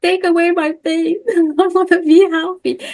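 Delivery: high, whiny mock plea with a laugh breaking in; hammed-up pained whimper, half laughing; pleading in pain. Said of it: "If someone is in a lot of pain".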